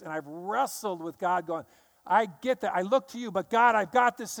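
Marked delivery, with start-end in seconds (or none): 2.1-4.4 raised, insistent pleading with God; pleading protest. Retold or imitated. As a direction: push back at God, louder and higher. Arguing with God, voiced self.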